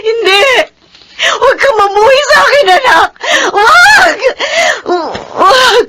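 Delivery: high, loud sobbing pleas; desperate crying mother; sobbing shout. Acted. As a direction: scream no through tears. Mother begs son not to hate.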